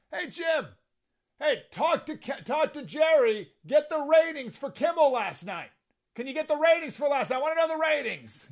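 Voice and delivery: high Trump impression. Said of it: "And he goes, he goes".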